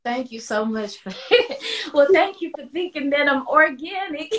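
teary laugh, voice wobbling; real laughter breaking into tears; laughing through tears. Real, not acted. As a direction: laugh it out while choking up.

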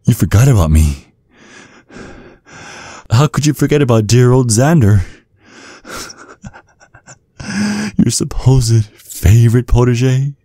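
high, sneering laugh and taunt; bitter mockery. Protege mocking the mentor who forgot him.

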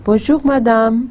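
Bonjour, madame। with the lift on madame; tune moves to madame. Shown as a demonstration. Teacher shows where the greeting lifts. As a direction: carry the tune to the last word. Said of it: "आखिरी word पे चली जाएगी".

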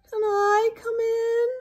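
high, loud, drawn moan. A narrator's reading. Hippo knocks at the door, moaning. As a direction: moan it plaintively as a storybook hippo. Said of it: "and moaned".

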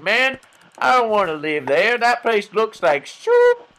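loud, high homeless-guy complaint; mock a hobo hollering in disgust; high-pitched, hammed-up hobo. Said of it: "random homeless guy that I'm impersonating".

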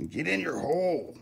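high silly cartoon voice; silly movie voice. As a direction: coax it like a movie creature. Silly voice to a connector.

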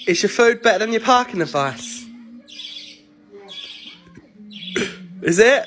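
loud, high-pitched challenge; needling challenge. Real, not acted. Child questions mum's parking advice, loudly challenging. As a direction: push the question loudly at her.

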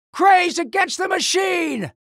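high, shouted battle cry. Acted. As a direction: call it out high like a fighting slogan. Game hero's battle cry.

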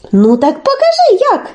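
put-on high child-like pleading voice; naive Telesyk. Telesyk asks Olenka to show him, high and naive. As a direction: innocently ask to be shown. Telesyk feigns not knowing how.

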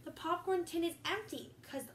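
a steady read-aloud storybook voice; reading to children. Acted. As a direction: read it to the kids like a bedtime story. Reading picture book aloud.

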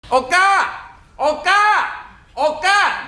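high calls from outside; repeated high shouting for the wife; calling out. Acted. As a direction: call for the wife from far off, high and long.

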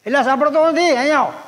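second call. Retold or imitated. Uncle calls the ignoring boy again, loud impatient shout. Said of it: "જી બાપાએ બીજી બૂમ પાડી".

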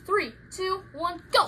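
normal talking voice

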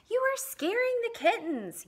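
high, loud reproach; scolding. Acted. Girl scolds Katie for scaring kittens, high and loud. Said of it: "said Sarah Ann".

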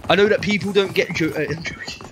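normal talking voice

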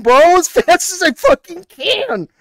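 high, loud, drawn-out yell while running; frantic shout. Real, not acted. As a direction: yell back in real panic. Fleeing the monster, defending himself.

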